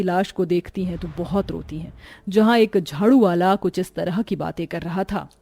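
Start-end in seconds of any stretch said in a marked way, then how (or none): none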